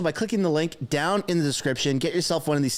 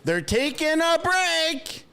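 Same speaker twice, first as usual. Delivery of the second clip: high, slow, amazed exclamation; 4 semitones higher, much slower than usual. Real, not acted. Streamer reacting to the suspension, high slow amazement. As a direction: marvel at the news. Amazed at the break being called.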